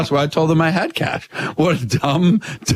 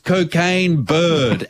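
rapper's name said very slowly; slow announcement; 3 semitones higher, much slower than usual. Retold or imitated. Retelling how the rapper slowly gave his name.

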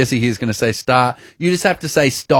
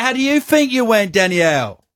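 mock British accent; 9 semitones higher than usual. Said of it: "You do the accent".

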